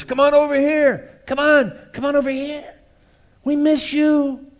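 high, loud beckoning yell; screaming world. Acted. The world screaming to lure believers back, high loud call. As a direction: call them back across the room. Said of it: "The voice of the world is screaming at us".

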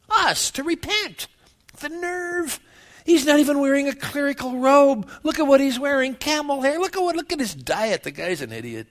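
mock the prophet as a snooty religious leader; sneering Pharisee. Voicing scornful Pharisees mocking John.

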